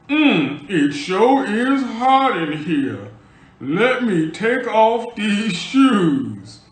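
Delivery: slow, loud, hammed-up seductive voice; Rasputia seducing. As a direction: drawl it out like a heavy flirt trying too hard. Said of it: "No, Rasputia".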